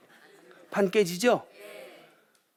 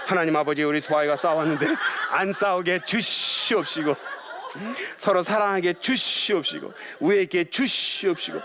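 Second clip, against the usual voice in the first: parent's prayer; 4 semitones higher than usual. Retold or imitated. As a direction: pray solemnly over the children. Parents praying over the kids.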